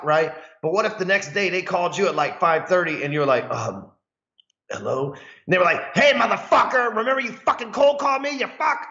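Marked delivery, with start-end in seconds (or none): 3.5-5.1 soft, sleepy answer; half-asleep phone pickup; groggy hello. Retold or imitated. 6.0-8.8 much higher, mocking payback rant; angry prospect. Angry prospect calling back early.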